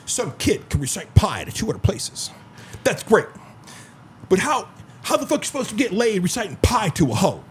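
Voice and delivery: brash, high-pitched comic impression; Andrew Tate. Podcaster retelling the pi joke to co-hosts, brash delivery imitated. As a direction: imitate a cocky punchline delivery, pitch jumping up. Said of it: "Andrew Tate's delivery is funny".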